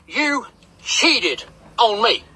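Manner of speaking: drawn-out mock accusation, word by word; slow, deliberate retold accusation. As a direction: imitate an aggrieved wife, very slowly. Imitating wife's slow accusation.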